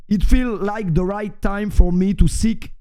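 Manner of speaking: put-on slow, deliberate English in a French accent. Streamer announcing a career move, French-accented English. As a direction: spell out the statement slowly, French accent. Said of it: "arrêtez de dire accent horrible".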